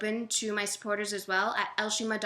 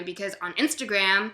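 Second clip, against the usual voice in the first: ordinary talk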